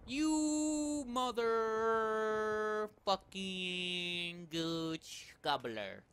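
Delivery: extremely slow, stretched-out swearing; drawn-out curse. Real, not acted. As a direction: stretch every word out as you curse.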